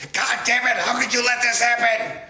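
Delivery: mock screaming Hillary. Vlogger imitating Hillary screaming at her aide. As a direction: ham up a furious woman screaming. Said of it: "I can hear her now screaming and swearing".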